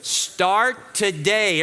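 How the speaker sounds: drawn out, START and TODAY each weighted; slow emphatic. Shown as a demonstration. Pastor gives congregation a slow call to repeat. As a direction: lay out "Start today" slowly like a motto. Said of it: "Everybody say that out loud with me".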